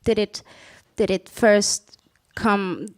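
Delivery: normal talking voice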